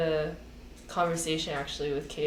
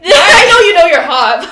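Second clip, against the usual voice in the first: laughing reply; 11 semitones higher, 19 dB louder than usual. Retold or imitated. Retold reply to friend's compliment.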